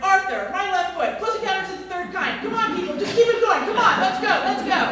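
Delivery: loud, high rapid-fire guesses; urgent shouting. Acted. Rob Reiner urging the team on, loud and high. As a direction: hurry everyone on, shouting.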